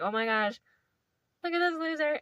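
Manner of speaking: high mocking jeer; mocking pack. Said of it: "the whole pack is like".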